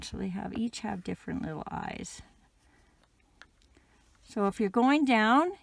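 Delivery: normal talking voice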